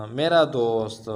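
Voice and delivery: ordinary delivery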